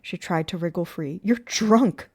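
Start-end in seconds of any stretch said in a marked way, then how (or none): 1.2-2.1 loud, raised rebuke; sharp, loud, higher voice; yelled accusation. A narrator's reading. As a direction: yell it at him in disgust. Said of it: "she yelled".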